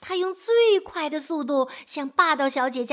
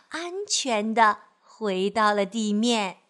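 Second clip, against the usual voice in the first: usual speaking voice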